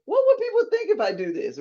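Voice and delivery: high, worried "what would people think"; doubting inner voice. Retold or imitated. Host voices the fear of what people think, worried.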